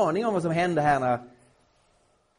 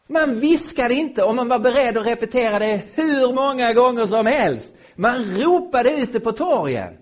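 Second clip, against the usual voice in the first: forceful proclamation; 7 semitones higher, 9 dB louder than usual. Real, not acted. Preacher refuting whisper-game analogy.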